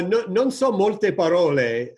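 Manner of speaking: usual speaking voice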